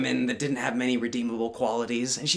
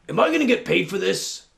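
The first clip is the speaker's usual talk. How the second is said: wolf voice; 4 semitones higher, 4 dB louder than usual. Acted.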